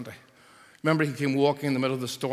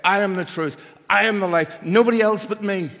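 higher and much louder, each I hammered; emphatic Jesus; 7 semitones higher, 10 dB louder than usual. Retold or imitated. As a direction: proclaim it as Jesus with force. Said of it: "Jesus said".

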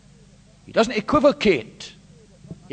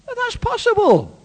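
loud, higher, driving sermon voice; full-force preaching; 13 semitones higher, 8 dB louder than usual. Real, not acted. Preacher confronting the congregation with verse thirteen, loud. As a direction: hammer the scripture home.

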